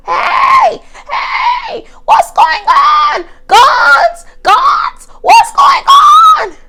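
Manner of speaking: very high, shrieking panicked manager; hammed-up frantic shout about guns; short Indian lady. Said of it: "imagine a short Indian lady".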